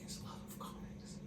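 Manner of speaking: whispered to himself; whispering. Real, not acted. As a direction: whisper the clue, thinking hard. Muttering the clue to himself.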